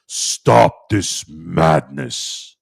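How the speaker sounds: slow, dramatic mock announcer; slowed-down imitation of the arena announcer; deep announcer voice. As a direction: draw out each word like a movie voiceover. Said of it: "You almost thought you were in a movie".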